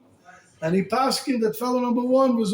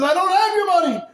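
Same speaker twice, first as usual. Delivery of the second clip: much higher and louder, a pleading cry; desperate protest; 11 semitones higher, 6 dB louder than usual. Retold or imitated. Man being punched protests to his attacker, high panicked cry. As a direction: squeal it out in panic.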